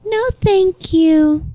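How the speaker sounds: high, slow, drawn-out polite refusal; mock polite voice. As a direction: imitate a sing-song customer-service brush-off. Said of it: "In a polite voice".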